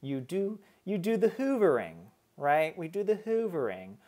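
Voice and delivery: light mock British accent. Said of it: "do I try to put on a British accent?".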